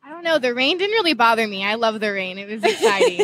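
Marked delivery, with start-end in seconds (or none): 2.4-3.2 laughing. Real, not acted. Joking about loving rain.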